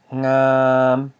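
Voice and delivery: ngam drawn out on a level pitch; sustained mid-tone ngam. Shown as a demonstration. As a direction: hold ngam long, loud and even. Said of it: "It's a mid tone".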